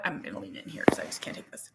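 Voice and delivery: fast confiding whisper. Real, not acted. Mom confiding to viewers, fast whisper. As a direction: lean in and whisper it.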